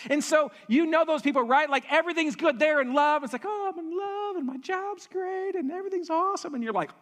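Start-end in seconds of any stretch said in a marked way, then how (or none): 3.4-6.5 giddy lover. Pretend. Mocking a giddy person in love.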